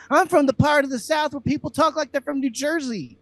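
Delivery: Theo Von. Acted. Comic mocking a rival's claimed roots, high mock hillbilly voice. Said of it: "This is how I talk".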